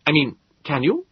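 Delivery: high, incredulous gentleman's voice; a curious, slightly rude host peering at the creature; astonished host. Acted. Host addresses Morlock.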